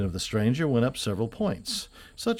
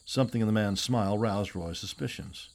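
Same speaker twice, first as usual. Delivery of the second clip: plain reading voice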